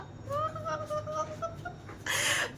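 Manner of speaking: high, pulsing squeal of glee; excited squeal. Real, not acted. Giddy about the coming reveal.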